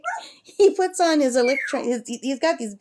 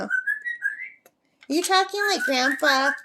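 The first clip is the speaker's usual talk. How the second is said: pet talk; 7 semitones higher, much slower than usual. Acted. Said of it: "asking the parrot sweetly".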